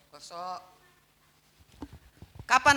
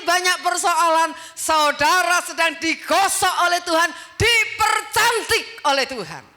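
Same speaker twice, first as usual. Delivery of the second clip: preaching at full force; 5 semitones higher, 7 dB louder than usual. Acted. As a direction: drive it home to the congregation. Preaching climax. (khotbah lantang dan lambat)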